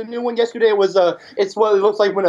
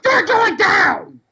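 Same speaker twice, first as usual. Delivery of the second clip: shouting; 10 semitones higher, much slower than usual. Pretend. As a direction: shout it, drawn out and rising. Trash talk wrestling challenge.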